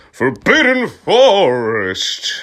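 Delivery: "Forbidden Forest!" yelled high, loud and slow; furious yell. Acted. Dumbledore yelling at the students, hammed-up fury. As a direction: stretch "Forbidden" out in mock rage. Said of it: "he yelled".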